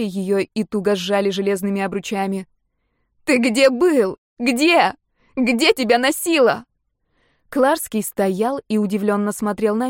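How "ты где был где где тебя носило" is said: high, raised, emotional cry; high-pitched outburst of a frantic woman; screamed. A narrator's reading. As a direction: burst out yelling the questions. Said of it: "а потом закричала".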